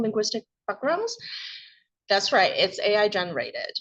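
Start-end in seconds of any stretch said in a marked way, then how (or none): none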